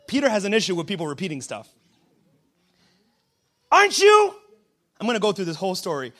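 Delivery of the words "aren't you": high-pitched, pointed challenge; accusing bystander. Acted. Third person confronting Peter, mock accusation. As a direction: raise the pitch to single him out. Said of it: "Third person comes up".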